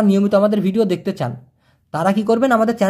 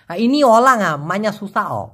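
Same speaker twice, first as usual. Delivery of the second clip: lightly imitated Chinese accent on ইনি অলাঙা মাইনা সুসা, slower; Chinese speaker's Malay; slower than usual. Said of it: "ওরা বলে ইনি অলাং মাইনা সুসা". (হালকা চাইনিজ টানে মালয় বলা)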